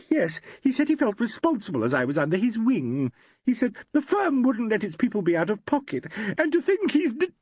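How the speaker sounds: a distraught actress explaining herself; tearful young woman. A narrator's reading. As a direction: gush out the worry near tears. Valerie breaking down.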